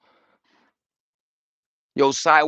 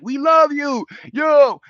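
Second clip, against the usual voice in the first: mock fan; 9 semitones higher, 4 dB louder, slower than usual. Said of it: "the audience to be like".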